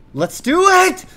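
loud, high, eager cry; real high-pitched hype; pumped-up shout. Real, not acted. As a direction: yell it to fire yourself up. Pumped after a success.

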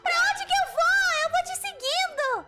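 loud, high exasperated complaint. Pretend. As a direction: raise the pitch and volume, fed up with a confusing partner. Exasperated at confusing partner. (reclamação exasperada)

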